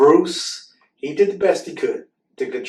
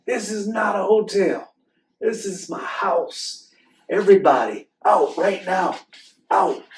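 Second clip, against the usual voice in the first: higher, hammed-up angry bear; lost his temper; 8 semitones higher, 6 dB louder than usual. Bruce kicking the animals out, mock angry shout. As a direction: throw everyone out in a storybook rage. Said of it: "Bruce lost his temper".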